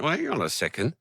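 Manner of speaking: high, amused self-catch; catching himself mid-complaint, amused realization; sudden realization. Retold or imitated. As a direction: laugh at yourself as it dawns on you. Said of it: "And then I went".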